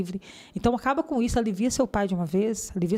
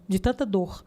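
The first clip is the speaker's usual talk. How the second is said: usual speaking voice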